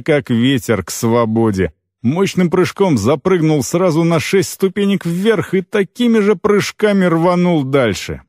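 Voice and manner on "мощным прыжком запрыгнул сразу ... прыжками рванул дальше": ordinary delivery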